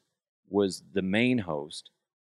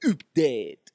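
put-on comic French accent, pitched up; exaggerated French-accented announcement; French Open host; 5 semitones higher, 4 dB louder than usual. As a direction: stretch it in a silly French accent. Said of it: "every time I'd say".

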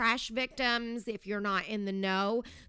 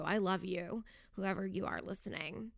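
ordinary talk, nothing marked

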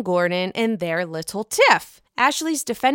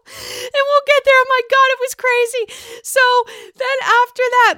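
very high, loud squeaky voice; high squeal; 6 dB louder than usual. Real, not acted. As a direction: squeak it out after your own joke. Breaks up after crude joke.

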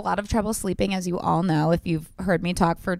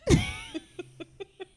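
high squealing laugh; 12 semitones higher, 4 dB quieter than usual. Real, not acted. Laughing at her own story.

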